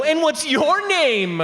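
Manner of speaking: high, drawn-out taunting question; mean doctor. Acted. Mean doctor squeezing a patient's belly, high mocking question. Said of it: "One of those mean doctors".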